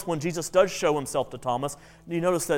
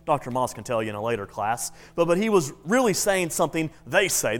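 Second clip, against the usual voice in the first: usual speaking voice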